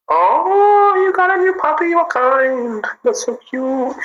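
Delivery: very high, cooing squeal; delighted high-pitched fawning; gushing over puppy. Real, not acted. Gushing over viewer's new puppy.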